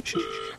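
soft, voiceless shushing; hushing. Real, not acted. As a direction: shush the room urgently for a phone call. Hushing while the phone rings.

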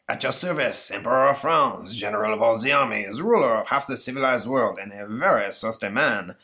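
put-on grand French-accented emperor; theatrical ghostly emperor; pompous Napoleon. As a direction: announce yourself grandly in a stagey French accent.

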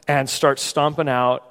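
ordinary delivery

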